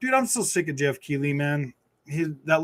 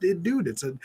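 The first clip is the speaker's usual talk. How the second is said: usual speaking voice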